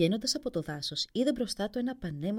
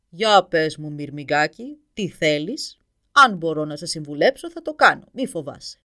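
loud, lower giant talking down to a little ant; huge dragon reassuring the prince, much louder; booming dragon; 3 semitones lower, 12 dB louder than usual. Acted. Giant dragon addresses the tiny prince.

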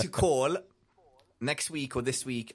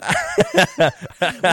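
loud laugh; 14 semitones higher, 11 dB louder than usual. Real, not acted. Big laugh at joke.

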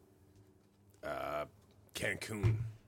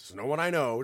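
ordinary talk

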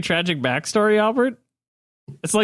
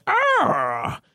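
put-on old man's cry; 11 semitones higher than usual. Old man calls from the bar.

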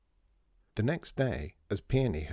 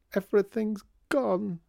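crying wail; 12 semitones higher, 5 dB louder than usual. A narrator's reading. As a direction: wail it as if in tears. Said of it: "Peony started crying even louder".

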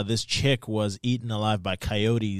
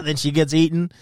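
normal talking voice

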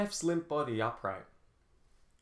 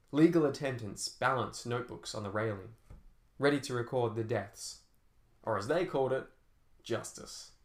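ordinary delivery

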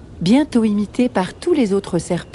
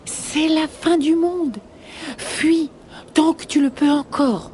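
hissing snake; 7 semitones higher than usual. Acted. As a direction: warn like a high-voiced snake. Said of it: "siffle un des serpents".

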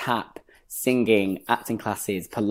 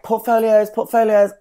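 high, louder call-out; casting director calling out; 11 semitones higher, 6 dB louder than usual. Retold or imitated. Casting director to waiting children, calling out. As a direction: call it out briskly to a roomful, pitched well up.

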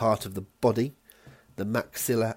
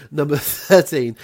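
laughing; 5 semitones higher, 9 dB louder than usual. Real, not acted. Still laughing on retake.

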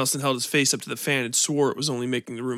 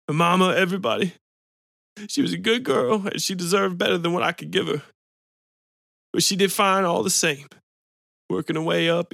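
crying; 4 semitones higher than usual. A narrator's reading. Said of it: "the man was actually crying".

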